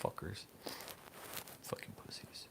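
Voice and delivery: whispered insult under the breath. Real, not acted. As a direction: hiss it barely audible. Muttered insult after hang-up.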